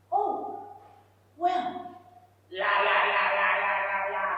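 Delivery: mock evasive manager, trailing into droning la-la-las; manager brushing her off. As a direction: imitate a manager's empty blah-blah. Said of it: "talk to the manager".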